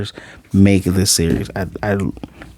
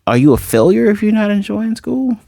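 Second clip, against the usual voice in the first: put-on high, small little-girl voice; pitched-up kid voice, light imitation; childlike Anya voice; 10 semitones higher than usual. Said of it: "And she's like".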